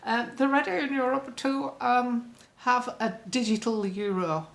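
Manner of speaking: a hint of a German accent on "ready in Europe"; Ursula's German accent. Vlogger mimics Ursula's announcement in a light German accent. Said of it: "She speaks a bit like".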